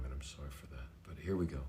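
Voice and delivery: usual speaking voice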